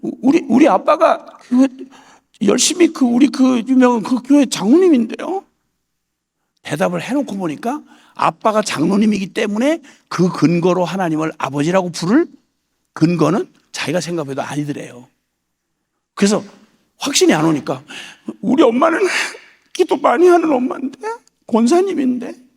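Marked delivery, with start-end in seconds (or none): 0.0-5.4 flustered excuse. Retold or imitated. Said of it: "둘러대기를 시작한 거죠". 18.4-22.5 unsure pleading. Retold or imitated. Unsure excuse to God.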